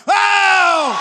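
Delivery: high, loud shouted oh; preacher's loud cry. Shown as a demonstration. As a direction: cry out to lead the church. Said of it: "make a loud voice".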